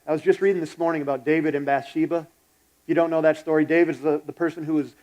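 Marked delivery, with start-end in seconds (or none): none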